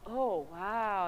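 underplayed sarcastic awe; mock impressed. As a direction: feign being impressed, softly. Mock reply to the bragging.

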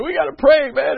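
high, loud urgent cry to pray; retold desperate plea. Pastor tells his team during the crisis, loud plea. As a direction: shout it like you're at the end of your rope. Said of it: "And I was literally crying".

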